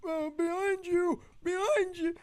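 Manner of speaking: squeaky scared warning; panicked warning. Retold or imitated. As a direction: warn a friend in panic. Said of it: "I'm like".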